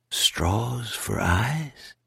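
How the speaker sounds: "Straws for eyes?" drawn out very slowly, rising on eyes; puzzled question. Acted. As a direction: repeat it slowly in disbelief. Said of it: "Ralph wrote".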